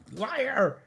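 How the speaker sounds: very high-pitched mock cry of accusation; hammed-up, shrieky comeback; retort in character. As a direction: fire it back in a squealed falsetto. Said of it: "Vivi fires back with".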